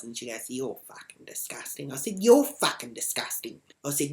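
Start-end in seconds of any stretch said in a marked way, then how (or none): 0.5-1.9 soft, muttered mock insult; retold insult. Retold insult traded back and forth. 2.2-3.6 loud, forceful retort; retold comeback, hit hard; insult thrown back.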